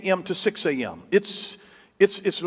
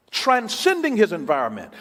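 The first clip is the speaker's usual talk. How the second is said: ordinary delivery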